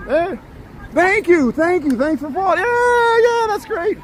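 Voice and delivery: very high, delighted thanks; thrilled squeal. Real, not acted. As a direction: squeal with joy at the fans. Fans say hello, thrilled.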